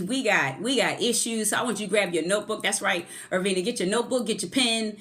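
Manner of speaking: usual speaking voice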